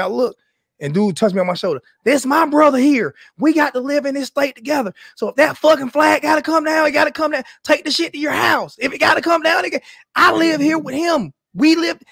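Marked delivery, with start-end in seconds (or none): 2.0-12.0 retold man's speech. Retold speech of a man at the rally.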